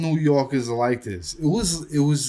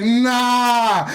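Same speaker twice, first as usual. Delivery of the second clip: high-pitched retold disbelieving cry; amazed disbelief; 8 semitones higher, 4 dB louder than usual. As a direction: refuse to believe your own eyes. Retells disbelief at seeing spurs.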